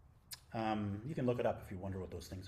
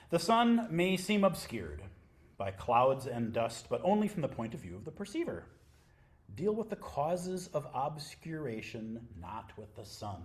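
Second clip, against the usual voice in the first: louder, deliberate reading voice; reading scripture aloud; 4 semitones higher, 10 dB louder than usual. Acted. To the sangha, reading Nisargadatta Maharaj aloud, louder. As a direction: read it out like a text for the room.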